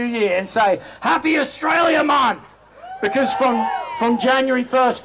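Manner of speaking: proud shouted rally address; rousing rally speech. Real, not acted. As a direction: fire up the crowd from the stage.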